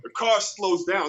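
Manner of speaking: normal talking voice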